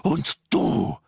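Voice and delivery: loud, high scolding that builds; enraged, commanding outburst; furious. A narrator's reading. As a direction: shout him down, climbing in pitch. Hermine rages at Harry, then Ron.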